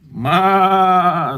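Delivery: loud, high, drawn-out goat bleat. Acted. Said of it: "says the goat".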